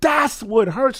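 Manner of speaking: high, slow, crying out in pain; real anguish over Halo; emotional cry. Real, not acted. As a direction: wail it like it hurts. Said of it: "'cause I'm getting emotional".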